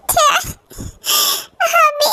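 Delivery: broken, drawn-out sob; sobbing through the word. Acted. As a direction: cry the word out slowly. [name] arrives crying.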